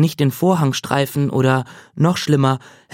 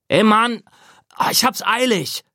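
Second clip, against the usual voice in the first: higher, impatient yell through a door; 8 semitones higher than usual. A narrator's reading. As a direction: shout it, desperate to get in. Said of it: "brüllte Scott".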